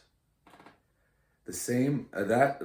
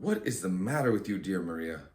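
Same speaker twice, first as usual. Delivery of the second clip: ordinary delivery, nothing marked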